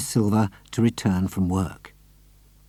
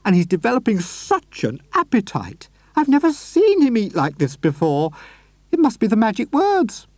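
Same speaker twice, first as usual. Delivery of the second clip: much higher doting lady; Missus Silver's voice; 10 semitones higher than usual. A narrator's reading. Missus Silver fussing over her tortoise, high and doting. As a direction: fuss over her pet.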